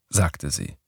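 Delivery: ordinary delivery, nothing marked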